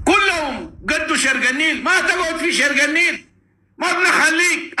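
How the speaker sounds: defiant shouting. Real, not acted. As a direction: shout the threat across the river. Threatening the enemy, shouting. (تحذير بصوت عال ومرتفع)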